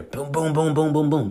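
high, spoken knock sounds; imitated door pounding.

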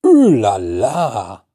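loud, drawn-out ooh la la; slow, delighted ooh la la. Shown as a demonstration. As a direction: savour the surprise.

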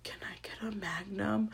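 whispered sheepish request; hushed customer. Retold or imitated. Customer asking the clerk for the biggest size, mock furtive whisper. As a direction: whisper it like a shy shopper. Shy customer asks for Magnum.

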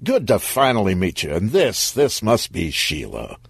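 ordinary delivery, nothing marked

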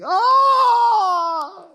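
long, high, loud yell; sustained high holler; retold scream. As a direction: scream it out as if plunging in. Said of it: "holding my eyes and saying".

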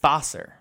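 "Vosser" said clearly with an F, as Fosser; demonstrated pronunciation. Shown as a demonstration. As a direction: show how "Vosser" starts with an F sound. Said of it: "How do you say it again?".

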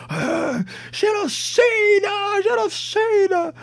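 high, drawn-out mocking shriek of genocida; mock high shout. Mocking the rival side in a political shouting match. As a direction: shriek it like a ridiculed opponent.

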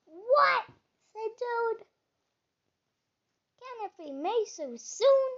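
startled sleepy Toad; surprised. A narrator's reading. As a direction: jolt awake in disbelief.

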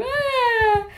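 high, tearful sob; a sob that won't stop; crying wail. Retold or imitated. (soluço a chorar)

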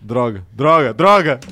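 cursing, building to a loud shout; frustrated cursing. Real, not acted. Quiz question stumps him.

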